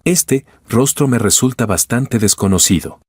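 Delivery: ordinary delivery, nothing marked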